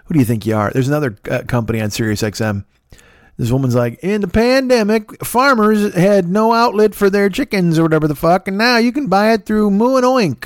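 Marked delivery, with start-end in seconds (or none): 4.0-10.5 high, hammed-up corn pone accent; mock an amateur ad woman with a put-on country accent; bad corn pone accent. Comedian mimicking a Sirius ad woman, high corn pone accent. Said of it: "She's got this bad corn pone accent".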